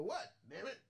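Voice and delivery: high mock-grumpy voice; grumpy Siri. Trucker voicing Siri grumpy, high voice. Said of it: "Siri go".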